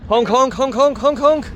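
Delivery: high honk honk horn imitation; playful high-pitched honking for the baby; silly clown.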